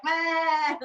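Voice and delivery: high mock baby wail; lightly mimicked baby cry. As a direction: bleat it like a newborn. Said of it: "that baby cried".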